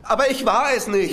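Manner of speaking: desperate protest. Acted. Verdächtiger wird festgenommen, protestiert.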